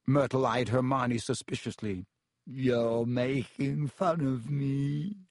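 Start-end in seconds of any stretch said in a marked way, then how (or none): 2.5-5.2 slow, wounded and tearful; holding back tears. Acted. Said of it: "silver tears welling rapidly".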